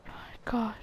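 whispered. Real, not acted. Shocked after plane dies.

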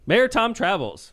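loud, high, slow announcement; big drawn-out show-host intro; segment announcer. Pretend. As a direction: proclaim the segment title.

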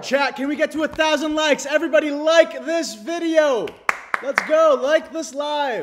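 high, loud rallying yell; excited streamer call to action; hyped-up shouting. Real, not acted. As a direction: whip the viewers up at full volume. Rallying viewers to like.

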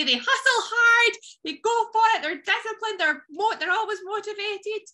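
high-pitched gung-ho motivational cheer; mock hype. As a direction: rattle them off like an over-keen hype coach. Mock-hype list of expected punchline answers.

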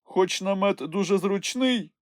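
a relaxed traveller giving advice; Snufkin's calm voice. A narrator's reading. (легкий голос мандрівника)

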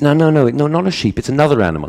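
a flustered teacher waving off a wrong answer; insistent no. Retold or imitated.

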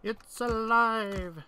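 high, slow, loud triumphant cry; mad scientist. Pretend. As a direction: drag it out like Frankenstein. Mad scientist quote after success.

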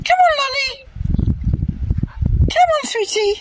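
high, soft pet-talk voice; coaxing a dog. Real, not acted. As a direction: sweet-talk the dog into following. Coaxing her dog Lolly along.

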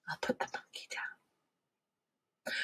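soft unvoiced whisper aside. Real, not acted. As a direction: whisper it off to the side.

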